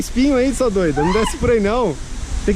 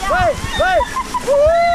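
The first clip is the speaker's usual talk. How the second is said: high excited cheer, pitched way up; 13 semitones higher than usual. Real, not acted. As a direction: cheer them on, thrilled. Cheering someone down the dune.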